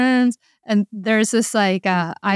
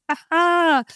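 much higher, panicky alarm voice; alarmed brain; 10 semitones higher than usual. Retold or imitated. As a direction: play the brain sounding the alarm. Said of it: "your brain is like".

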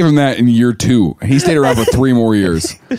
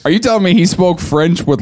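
normal talking voice

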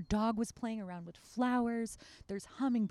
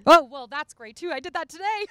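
excited burst; 8 semitones higher, 14 dB louder than usual. Real, not acted. Surprised laughing reply.

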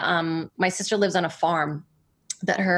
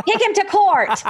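high, louder joking indignation through a laugh; mock outrage; 12 semitones higher, 5 dB louder than usual. Disputing her friend's story, laughing mock indignation. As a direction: play it as a hammed-up threat. Joking about his version.

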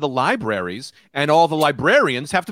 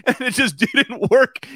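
usual speaking voice

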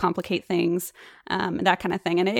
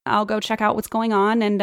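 ordinary talk, nothing marked

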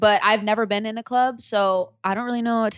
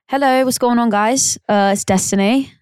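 a light put-on British accent, a touch louder; chatty hello in a mild British accent; 4 dB louder than usual. Shown as a demonstration. Guest shows the host her British accent, lightly. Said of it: "I'm good at a British accent".

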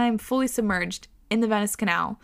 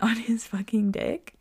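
usual speaking voice

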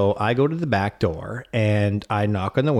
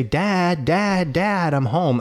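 put-on higher sing-song call of a kid; child calling out; 7 semitones higher, much slower than usual. Dad imitating son calling home. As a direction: call out like the little boy arriving home.

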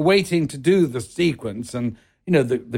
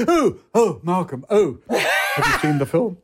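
excited Gielgud; 3 semitones higher than usual. Retold or imitated. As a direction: gush at Malcolm in the street. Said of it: "He went".

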